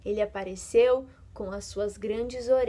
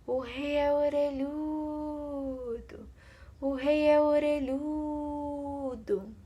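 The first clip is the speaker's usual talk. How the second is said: slow, soft, higher windy sighing voice; tree blowing in the wind; 4 semitones higher, 4 dB quieter, much slower than usual. Acted. The tree sighing the secret at night, soft and slow. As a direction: breathe the words out like wind through leaves. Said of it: "a árvore soprava".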